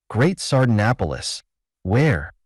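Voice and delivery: usual speaking voice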